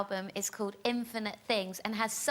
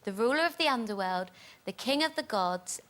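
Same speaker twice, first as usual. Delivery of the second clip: usual speaking voice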